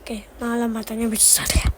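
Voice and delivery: soft unvoiced whisper. Real, not acted. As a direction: whisper it to the viewers. Streamer comments in a hush.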